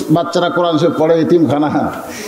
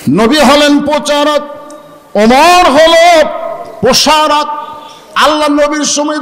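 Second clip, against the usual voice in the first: preaching shout; 7 semitones higher than usual. Real, not acted. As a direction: drag out Omar হলো and shout প্রসারক. Preacher climaxes on key point.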